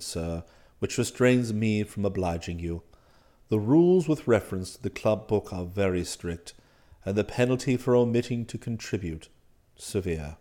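low, soft, formal manservant voice; unruffled valet. Acted. Valet explains club rules to master, unruffled. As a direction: explain deferentially, deep and composed.

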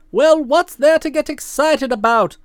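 usual speaking voice

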